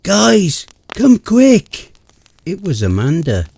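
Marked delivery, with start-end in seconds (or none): 0.0-1.9 high, loud scream for help. Acted. Narrator screams to friends after finding body, loud scream. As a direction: scream for the others. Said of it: "I screamed".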